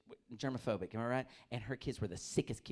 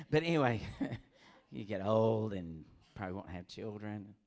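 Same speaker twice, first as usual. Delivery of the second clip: ordinary talk, nothing marked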